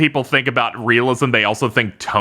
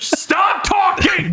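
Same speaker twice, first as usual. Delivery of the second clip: high, drawn-out yell; hammed-up outraged scream; mock tantrum shout; much slower than usual. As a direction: shout it slowly, pitched way up. Mock domestic tantrum, acted.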